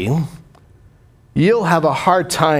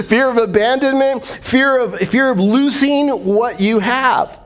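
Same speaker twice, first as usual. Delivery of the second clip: laughing through; 7 semitones higher than usual. Real, not acted. Laughing while listing fears.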